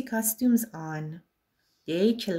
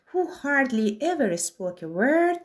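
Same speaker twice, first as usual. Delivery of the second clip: ordinary delivery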